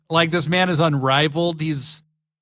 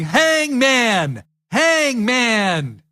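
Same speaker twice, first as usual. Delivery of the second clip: mock repeated call; 7 semitones higher, much slower than usual. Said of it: "And Caster just keeps going".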